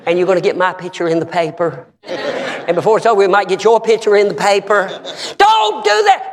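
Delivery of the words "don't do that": suddenly high-pitched comic plea; high emphatic warning. Real, not acted. Preacher warning congregation off fighting, high-pitched cry.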